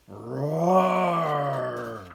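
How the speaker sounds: loud, far-off roar; lion roar. Acted. Lion roars.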